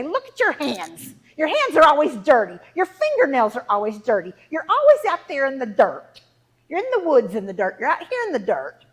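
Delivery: loud, exasperated nagging; scolding mother. Acted. Mother scolding her daughter over dirty hands, loud exasperation. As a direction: scold a child, punching the complaints loudly.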